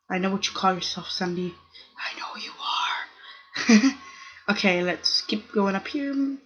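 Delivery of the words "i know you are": hushed, breathless whisper to the screen; a playful whispered dig at a fake player; sneaky whisper. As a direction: whisper it like a secret taunt. Teasing a fake-named player.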